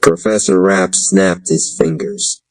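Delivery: ordinary delivery, nothing marked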